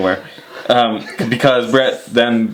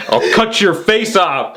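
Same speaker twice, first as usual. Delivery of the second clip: laughing; 10 semitones higher, slower than usual. Retold or imitated. Acted quote while laughing.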